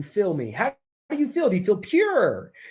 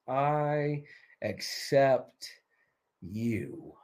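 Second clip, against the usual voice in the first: very stretched words, falling softer and lower; slow dictation; 4 semitones lower, 8 dB quieter, much slower than usual. Shown as a demonstration. Coach repeating words to write down, slow dictation. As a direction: draw each word out and let it sink. Dictating the phrase once more.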